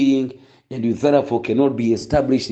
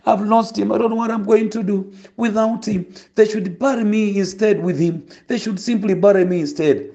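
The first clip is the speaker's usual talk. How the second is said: mourning mother imitated; 7 semitones higher than usual. Pastor imitating a mother mourning her son, high wailing lament. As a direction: imitate a grieving mother crying at the grave. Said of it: "you know how people cry and they mourn".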